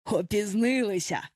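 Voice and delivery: put-on mildly swaggering boy's announcement; proud exclamation. A narrator's reading. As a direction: announce it with a touch of pride. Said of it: "гордо вигукнув Базіка". (трохи гордовитий вигук)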